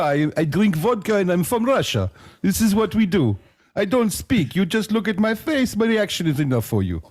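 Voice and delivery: high, hammed-up Russian accent; mock Russian accent, pitched high. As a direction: play a stone-faced Russian vodka drinker in a thick Russian accent. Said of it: "Too many accents in one day".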